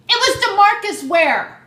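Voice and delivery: high, loud reveal; excited shout. Real, not acted. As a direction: shout the big name like a starstruck fan. I was so excited.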